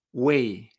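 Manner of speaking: low "wei" as u-e-i; correct pronunciation. Shown as a demonstration. Teacher blends the three spelled vowels, correct pronunciation. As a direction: let the e sound in "wei". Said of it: "U E I".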